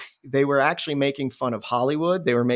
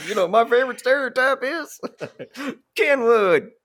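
high, loud, laughing through the joke; laughing mock punchline; 10 semitones higher, 3 dB louder than usual. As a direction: crack up while retelling the pun. Mocking friend's failed stereotype joke.

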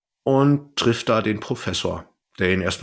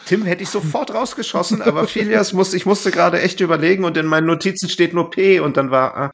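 flustered excitement; 8 semitones higher, 3 dB louder than usual. Real, not acted. Flustered about forgetting the name.

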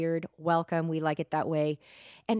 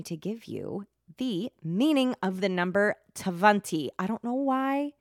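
"twenty" said as "tvanty" in a mock Russian accent, a bit louder; saucy mock Russian accent on "twenty"; random Russian accent; 2 semitones higher, 4 dB louder than usual. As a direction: throw in a playful fake Russian "twenty". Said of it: "I just did that with a random Russian accent".

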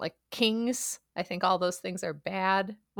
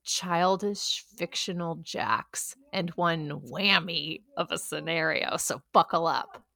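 announcer; 5 dB louder than usual. Acted. Game intro announcer.

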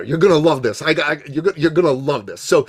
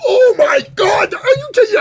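high, loud, stretched mock wail of disbelief; shocked outcry; 6 dB louder than usual. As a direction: cry out like you can't believe the request. Participants' reaction to sales calls.

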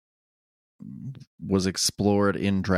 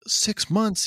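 high-pitched mock dismay; whiny kid's incredulous question, jumping way up; Gohan; 12 semitones higher than usual. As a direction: squeak it like a dismayed child. Retelling Gohan's upset line.

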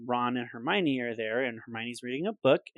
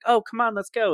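put-on higher, louder urgent girl; eager Hermione; 10 semitones higher, 6 dB louder than usual. S0 voicing Hermione rushing the boys off, higher eager call. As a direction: hurry them along excitedly. Hermione voiced in retold scene.

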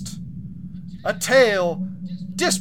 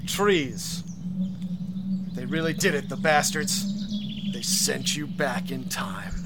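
story narration. Acted.

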